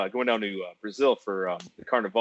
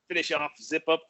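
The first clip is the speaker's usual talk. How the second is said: ordinary delivery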